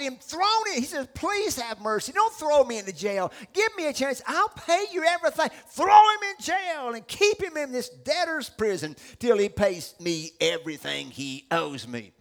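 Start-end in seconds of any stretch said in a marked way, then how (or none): 1.1-5.5 loud plea climbing higher; desperate pleading. Acted. Debtor facing jail, pleading desperately. Acts debtor begging not to be jailed. 5.7-12.1 harsh command. Acted. Servant having his debtor jailed, harsh shouted order.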